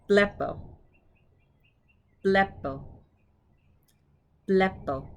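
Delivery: "Blepo" stressed up front; stress on first syllable. Shown as a demonstration. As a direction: hit the start of "Blepo", drop on the end. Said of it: "it's on the first syllable".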